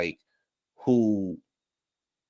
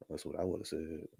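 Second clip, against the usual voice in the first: ordinary talk, nothing marked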